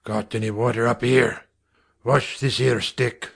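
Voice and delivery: a hint of a gruff Cockney father; rough father. A narrator's reading. Father ordering his wife, gruff and curt.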